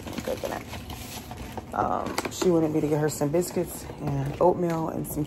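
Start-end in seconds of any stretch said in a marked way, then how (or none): 0.1-1.7 soft breathy whisper. Pretend. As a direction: whisper it playfully. Repeated in whisper.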